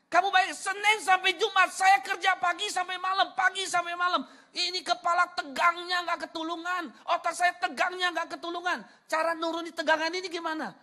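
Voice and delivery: high, loud, hammering complaint; acted stressed rant. Preacher imitating friend strained by work, ranting loudly. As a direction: rant like an overworked friend justifying himself.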